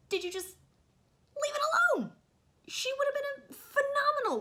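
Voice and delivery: high, loud exasperated cry; exasperated outburst. Real, not acted. Reviewer ranting at whoever changed the actress's accent, exasperated outburst. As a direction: burst out in frustration, pitch shooting up.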